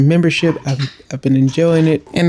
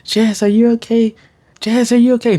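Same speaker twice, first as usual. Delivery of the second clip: higher, worried family asking; a family member checking on her, worried; concerned family; 7 semitones higher than usual. Retold or imitated. Family asking Jazz if she's okay, higher worried voice. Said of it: "here comes my family".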